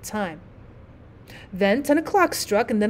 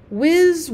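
whizzing firework sound; 6 semitones higher, 4 dB louder than usual. A narrator's reading.

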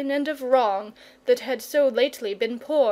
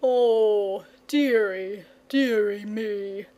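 slow, drawn-out dragon moan; mild woeful lament; much slower than usual. Acted. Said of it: "moaned the dragon".

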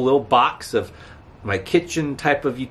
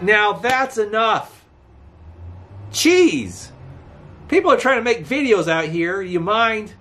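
annoyed scolding; 8 semitones higher than usual. Real, not acted. Scolding noisy traffic while filming.

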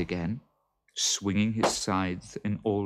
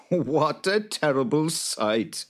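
laughing; 8 semitones higher, 5 dB louder than usual. Acted. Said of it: "laughed Jude".

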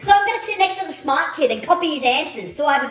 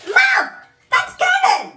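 high, excited call to Mom; 13 semitones higher than usual. Acted. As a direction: squeal it out to Mom.

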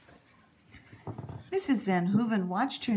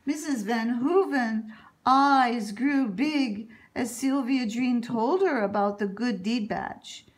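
ordinary delivery

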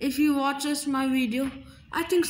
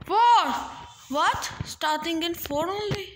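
usual speaking voice

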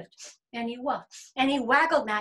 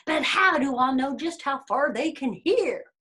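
louder, challenging sneer; sneering bear; 3 semitones higher, 7 dB louder than usual. A narrator's reading. Bear doubting the rabbit's hearing, sneering. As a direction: throw out a sceptical challenge. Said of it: "said the bear with a sneer".

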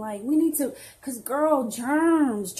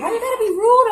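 much higher and louder, hurt indignation; offended outburst; 11 semitones higher, 10 dB louder, faster than usual. Acted. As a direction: snap back at the insult, loud and high. Friend calls her always eating.

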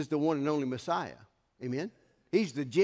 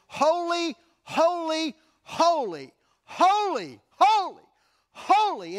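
high, loud cry of holy; shouted holy; 12 semitones higher, 7 dB louder, slower than usual. Acted. Preacher voicing the beasts crying holy at full force. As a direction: cry out each holy to the rafters.

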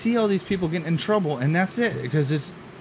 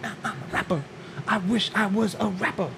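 mock wannabe rapper; 3 semitones higher, 7 dB quieter than usual. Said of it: "He's doing that".

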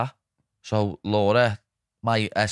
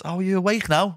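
high, teasing wake-up jibe; sarcastic mock-sweet question, pitched up; mocking mate; 10 semitones higher than usual. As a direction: poke fun at a sleepy mate. Mate mocking him for snoring.

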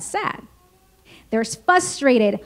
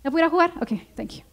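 much softer, half-mumbled brush-off; bored kid brushing parent off; 9 dB quieter, much faster than usual. Retold or imitated. Child barely listening to a lecturing parent, mumbled and much softer. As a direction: tune the parent out with a quiet, absent okay. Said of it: "They're like".

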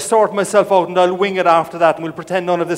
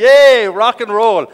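slow, louder cheer; 7 dB louder, slower than usual. Real, not acted. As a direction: stretch the yay out as a reward. Cheering crowd's answer.

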